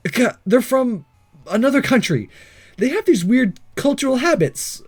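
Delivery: voicing the protagonist. Hero nervously explaining the foreign girls to the ninja. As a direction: talk fast and defensive like a caught hero.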